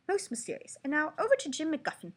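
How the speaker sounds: put-on British anchor, pitched high; British newscaster. A narrator's reading. Newscaster handing over to Jim, British accent. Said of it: "please excuse my horrible British accent".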